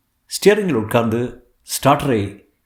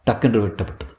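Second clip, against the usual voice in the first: plain reading voice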